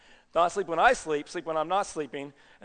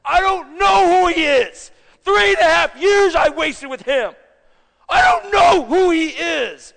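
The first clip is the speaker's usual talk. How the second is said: high, drawn-out angry shout; cursing-mad outburst at full voice; furious denial; 11 semitones higher, 12 dB louder, slower than usual. Acted. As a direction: bellow it slowly, fed up and mad. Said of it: "he got so mad".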